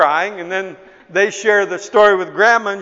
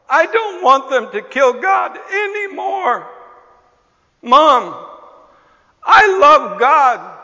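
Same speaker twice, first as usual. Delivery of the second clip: put-on high, loud child pleading with mom; wailed plea of a four-year-old; tearful little boy; 6 semitones higher, 4 dB louder than usual. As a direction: imitate an upset small boy, voice lifted high. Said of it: "big crocodile tears forming in his eyes".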